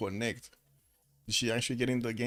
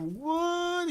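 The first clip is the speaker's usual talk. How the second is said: surprised. Real, not acted. Shocked at game stats.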